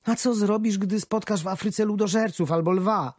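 wife anxious about the trip; worried wife. A narrator's reading. Wife voiced worried at station.

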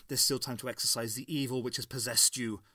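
ordinary delivery

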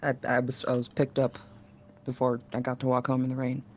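soft, halting, stammering wounded voice; hurt winged character. Acted. Injured winged friend reunited and comforting, soft halting stammer. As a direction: stammer softly through the pain. Emotional roleplay scene between characters.

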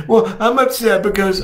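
put-on high child's voice; child explaining. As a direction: voice a little kid finally using words. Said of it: "as they do that, is".

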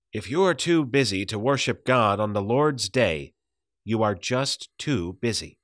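usual speaking voice

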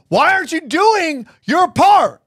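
high, pushy accusing voice; mock the angry farmer's demand; aggressive heckler. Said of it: "it starts to get really aggressive".

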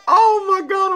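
high, loud exasperated cry; exasperated shout. Real, not acted. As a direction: cry out at your own blunder. Disbelief at own mistake.

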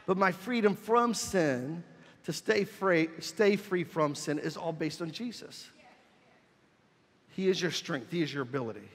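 normal talking voice

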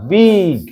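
বিগ stretched out on its "i"; slow classroom model of বিগ; short-i vowel. Shown as a demonstration. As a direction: hold the vowel in বিগ long. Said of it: "হস্যিকার".

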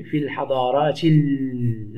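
في الحضارات ال- slowly joined, ending on a long L; silent hamza, linked. Shown as a demonstration. Teacher showing the unspoken hamza, الحضارات ال- slowly linked. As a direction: glide through الحضارات into a held L, no stop. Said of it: "لا أنطقها".

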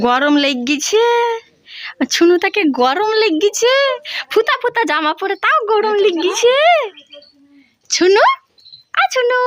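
put-on high, loud cooing to a baby; baby talk. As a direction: coo sweetly to coax the little one. Mother cooing at her baby.

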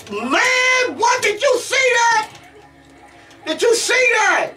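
very high-pitched, thrilled disbelief; amazed outburst. Real, not acted. Player asking viewers if they saw it, amazed outburst. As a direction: cry out in astonished hype.

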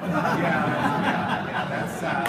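host laughing at the Gordon measurement joke. Real, not acted.

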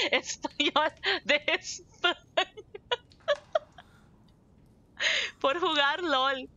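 laughing through the words. Real, not acted. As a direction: laugh at the wrong guess. Laughs at the accent guess.

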